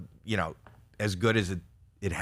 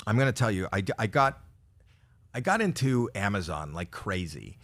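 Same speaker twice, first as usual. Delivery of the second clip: ordinary talk, nothing marked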